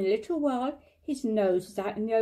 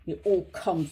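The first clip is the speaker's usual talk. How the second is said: grumpy and lower, a touch sulky; cross; 6 semitones lower than usual. A narrator's reading. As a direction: mutter it crossly, lower pitch. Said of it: "said Pooh crossly".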